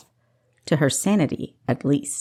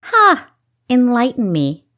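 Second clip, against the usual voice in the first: loud, higher scornful "Ha!"; mocking challenge; 7 semitones higher, 7 dB louder than usual. A narrator's reading. Thief mocking the caged man, a loud scoffing dare. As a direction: laugh it off and dare him to go on.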